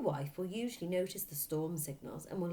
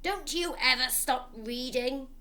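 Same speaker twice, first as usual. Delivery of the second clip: loud, slow angry snap at his daughter; snapping father; 7 semitones higher, 8 dB louder than usual. A narrator's reading. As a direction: bite the words out like an irritated father. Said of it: "he snapped at her".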